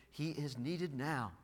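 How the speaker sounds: ordinary talk, nothing marked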